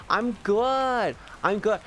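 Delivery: squeaky upbeat I'm good; chirpy reply. Real, not acted. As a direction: bounce it back brightly to chat. Answers chat's how are you.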